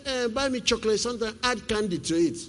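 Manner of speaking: a hint of a child asking for sweets; little boy's voice. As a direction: son asking dad for chocolate, mild child voice. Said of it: "He said".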